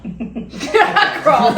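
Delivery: mocking echo through a laugh; laughing mimic. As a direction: repeat his words while laughing, drawn out. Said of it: "he said".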